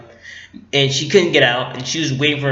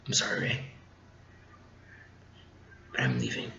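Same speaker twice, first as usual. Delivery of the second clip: Finn's hushed goodbye; 19 dB quieter, faster than usual. Retold or imitated. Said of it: "and then it's like".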